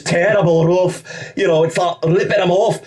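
hammed-up Scottish accent, pitched high. Shown as a demonstration. Actor showing the bad Scottish accent he had to do, high mock-Scottish. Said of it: "I had to do, like, this Scottish".

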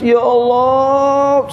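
high, drawn-out cry to God; imitated crying out. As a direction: cry out like someone weeping in prayer. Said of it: "sanggup berteriak dia". (menirukan teriakan tangis, panjang dan tinggi)

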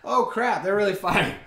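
chuckle, then realize it's real; imitating a laughing bystander who sees a real fight; nervous mock laugh. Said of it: "everybody still kinda laughing".

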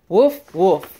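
playful barking woof; cheerful puppy woof for kids; dog bark. Pretend. Dog barks in picture book.

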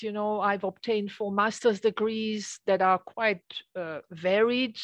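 ordinary talk

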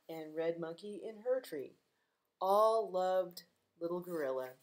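gentle read-aloud storytime voice; reading to children. Acted. Librarian reading a picture book to kids, gently.

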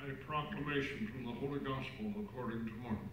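cathedral echo. Real, not acted. Gospel read far off.

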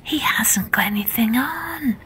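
hushed whisper, passing it along. Acted. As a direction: whisper it to your neighbour. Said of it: "one person whispered to another".

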